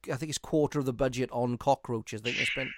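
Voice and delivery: usual speaking voice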